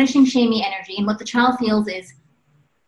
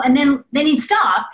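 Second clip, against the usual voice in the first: ordinary talk, nothing marked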